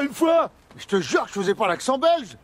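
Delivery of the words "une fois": "une fois" shouted high and loud, falling firmly; insistent protest. Acted. French cop insisting to the officer, falling high shout.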